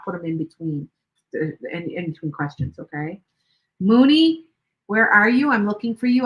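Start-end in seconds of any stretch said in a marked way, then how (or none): 3.8-6.2 loud, high call across the room. Real, not acted. Streamer calling out to a chat viewer, loud high call. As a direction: call her name out to find her.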